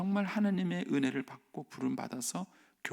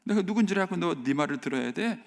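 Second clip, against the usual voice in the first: loud, high scornful sneer; a king mocking a god he doesn't know; arrogant Pharaoh; 6 semitones higher, 6 dB louder than usual. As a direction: sneer at Moses as a haughty king. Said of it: "이렇게 말하면서 얘기하는 거예요".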